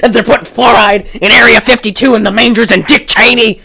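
confused mock tirade; rambling conspiracy theorist. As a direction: rattle off random conspiracies like a crank. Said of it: "sounds like he has Alzheimer's".

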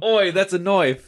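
mock Australian accent. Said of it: "That's Australian".

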